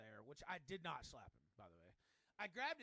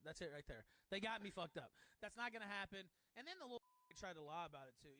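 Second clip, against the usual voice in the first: ordinary talk, nothing marked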